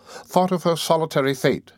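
ordinary delivery, nothing marked

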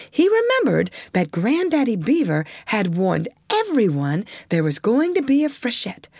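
plain reading voice

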